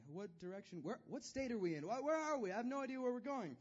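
rising to high, loud panic; lost driver. Retold or imitated. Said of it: "I'm just like".

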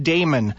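"Damon" starting like the word day; "Damon" with a long ay first; proper pronunciation. Shown as a demonstration. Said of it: "it's supposed to be pronounced".

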